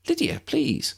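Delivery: put-on friendly young woman. A narrator's reading. Lydia voiced.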